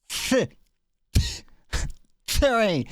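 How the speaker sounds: a high, sharp T then a forced trill in "train"; another rolled R try. Shown as a demonstration.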